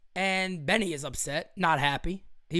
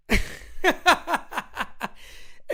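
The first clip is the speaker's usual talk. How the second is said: breathless laugh; 5 semitones higher than usual. Real, not acted. Breathless laugh after joke.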